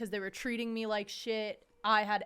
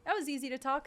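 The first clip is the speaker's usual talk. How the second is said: excited; 8 semitones higher, 4 dB louder than usual. Retold or imitated. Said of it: "I'm like".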